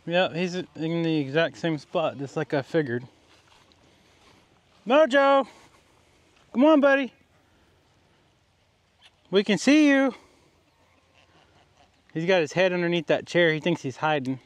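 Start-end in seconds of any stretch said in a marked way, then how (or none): 4.9-10.1 high, loud call to the dog. Real, not acted. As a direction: call him from across the yard. Calling the hiding dog.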